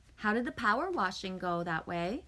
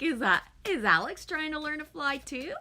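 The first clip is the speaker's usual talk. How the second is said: mock surprised question; 7 semitones higher, 4 dB louder than usual. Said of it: "And I'm like".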